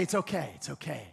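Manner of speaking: soft and low, comforting; tender reassurance. Retold or imitated. God reassuring his child, soft and low. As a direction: gently reassure like a father. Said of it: "tug on that hand and say".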